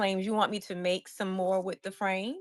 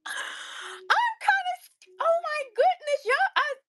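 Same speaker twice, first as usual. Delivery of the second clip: overwhelmed near tears. Real, not acted. Overwhelmed by selling out.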